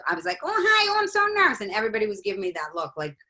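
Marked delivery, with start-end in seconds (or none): 0.4-1.6 very high, loud, nervous character squeal; hammed-up nervous oddball, pitched way up; It's Pat character voice. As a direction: stay fully committed to a high cartoon voice. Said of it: "I was acting like".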